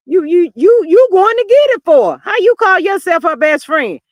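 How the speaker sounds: loud, high-pitched indignant rant; imitated scolding at full volume; retold tirade. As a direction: rip into them, imitating a furious telling-off. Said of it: "she ripped a hole in that dude".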